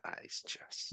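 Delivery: very soft, under the breath; muttered disbelief. Retold or imitated. Reacting to his mate's postcard idea, muttered disbelief. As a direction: barely voice a private objection.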